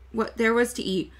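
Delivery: usual speaking voice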